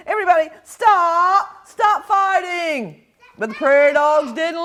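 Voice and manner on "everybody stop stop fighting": high, urgent plea to stop; pleading shout. Acted. Pipsqueak trying to stop the war.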